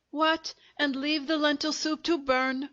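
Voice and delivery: lightly voiced exasperated woman; feminine voice. A narrator's reading. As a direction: answer as an annoyed wife from the next room. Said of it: "an undoubtedly feminine voice from the depths".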